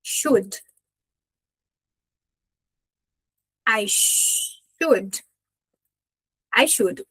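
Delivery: "should" with sh as in Xuxa and short dry U; stopped, dry "I should". Shown as a demonstration. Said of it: "um X de Xuxa e um U de seco".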